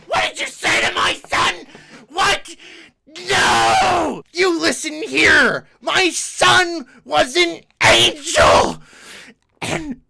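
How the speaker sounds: high, screeching furious yell; furious shout. Retold or imitated. Mom screaming at the rude man, furious shout. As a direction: scream it like a pterodactyl. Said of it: "she just went full pterodactyl scream".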